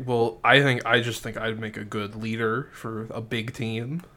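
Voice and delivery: soft, unsure mumble trailing away; tentative pitch from a shy kid; timid kid Kev. Acted. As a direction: hedge it quietly, losing confidence. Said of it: "Kev's like".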